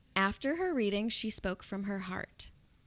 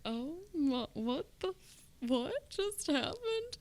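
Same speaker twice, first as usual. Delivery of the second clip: baffled; 8 semitones higher, 3 dB quieter than usual. Retold or imitated. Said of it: "And I was like".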